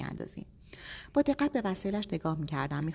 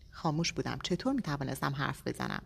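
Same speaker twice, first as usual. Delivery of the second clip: ordinary delivery, nothing marked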